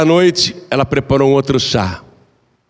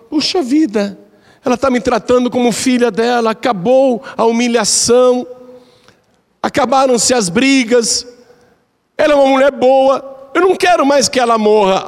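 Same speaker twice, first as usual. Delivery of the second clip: high, lightly voiced regretful young woman; remorseful daughter-in-law; 10 semitones higher than usual. The daughter-in-law admits she no longer wants her dead, high and regretful. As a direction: plead it with rising regret. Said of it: "a nora ficou arrependida".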